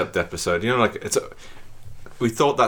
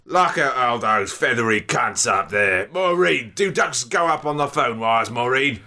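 Cockney musing to Maureen; puzzled Cockney; 3 semitones higher than usual. Acted. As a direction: wonder about the birds in a put-on Cockney.

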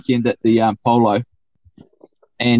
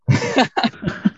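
laughing; 9 semitones higher than usual. Real, not acted. Laugh after his story.

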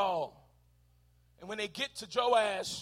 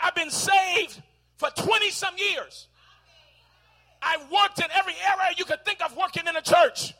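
entitled claim; 8 semitones higher, 6 dB louder than usual. Pretend. Churchgoer claiming the reward they deserve, loudly. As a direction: insist you earned your moment.

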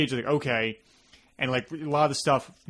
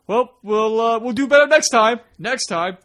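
high, louder sheepish promise; imitating Cutter; 9 semitones higher, 8 dB louder than usual. Cutter shrugging off the failed trick, imitated higher. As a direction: brush off the disaster lightly. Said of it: "Cutter's just like".

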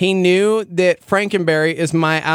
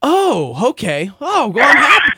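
mock relieved reaction; 6 semitones higher than usual. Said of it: "And it's like".

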